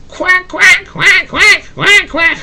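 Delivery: high squawky duck quacks; a duck crying out in a scuffle; duck quacking. Acted. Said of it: "cried Ping".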